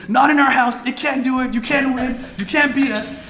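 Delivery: rowdy cricket fan yelling at the TV cameras; reenacted yelling. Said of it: "me screaming and yelling on camera".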